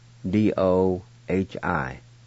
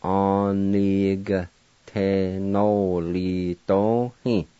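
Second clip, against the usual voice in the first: "Onigtenolidohi" drawn out syllable by syllable, a-ni-ga-te-no-li-do-hi; very slow pronunciation; much slower than usual. Shown as a demonstration. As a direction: spell it out in sound, very slowly. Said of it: "pronounced like this".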